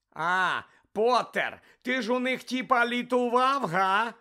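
high, loud sneering jeer. Acted. Malfoy jeering at Harry, high loud sneer. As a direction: grin nastily while you mock. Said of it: "вишкірився Мелфой".

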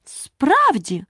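high, drawn-out astonished question; sincere amazement. A narrator's reading. Alice can only marvel at the knight's claim, amazed. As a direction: stretch it out in wide-eyed surprise. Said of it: "якнайщиріше здивуватися Аліса".

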